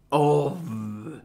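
ordinary talk, nothing marked

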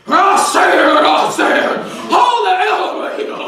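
loud sassy woman. Acted. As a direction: yell it like a sassy lady at the elevator.

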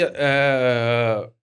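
ordinary delivery

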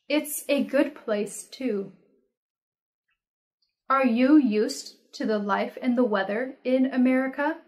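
ordinary talk

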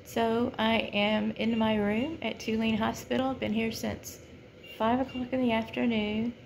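ordinary talk, nothing marked

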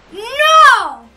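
high, loud cry of no; anguished yell. Real, not acted. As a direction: scream at the loss. Losing again, yelling.

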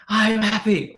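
high, loud chant from an open Jeep; re-enacted high-energy yell; yelled affirmation. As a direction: shout it out to the whole street. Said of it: "put some energy into it".

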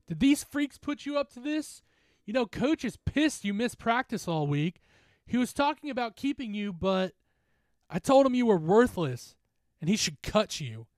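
high, loud jeering; taunting bully. Acted. Drunk bully confronting a teammate at the fire, taunting.

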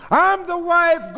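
high, loud, drawn-out insistence; crying out. A narrator's reading. Goldie claiming her place with the doctor, loud cry. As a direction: cry out indignantly. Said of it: "Goldie cried".

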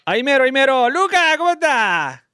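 high, loud hello; excited greeting. Real, not acted. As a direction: welcome the caller with a burst of energy. Greets new caller after connecting.

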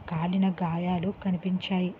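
ordinary delivery, nothing marked

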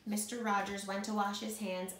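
ordinary delivery, nothing marked

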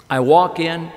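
ordinary talk

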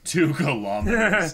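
high, loud burst of astonishment; real astonished outburst; amazed exclamation. Real, not acted. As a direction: exclaim it in disbelief. Astonished reaction to what is watched.

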